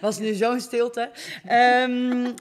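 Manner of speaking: lachend, uitgerekt en luider gezegd; laughing. Real, not acted. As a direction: lach het weg, net iets luider. Lacht om de stilte. (lachend en luider)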